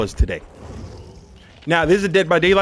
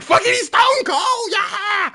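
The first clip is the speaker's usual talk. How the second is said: much higher, slow cheering shout; careless fan shout; 13 semitones higher, 3 dB louder, slower than usual. Retold or imitated. Fans shrugging off the loss, shouting. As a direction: cheer like you don't care he lost.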